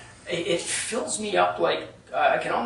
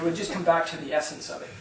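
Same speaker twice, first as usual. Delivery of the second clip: usual speaking voice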